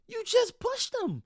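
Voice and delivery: high, slow cry of disbelief; incredulous. Real, not acted. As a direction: stretch it out in utter disbelief. Repeating the foul complaint.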